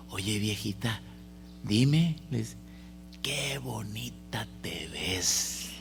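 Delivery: lightly voiced old man, whispered; a hushed, sweet old man's compliment. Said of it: "le dice el viejito". (un viejito susurrando un piropo)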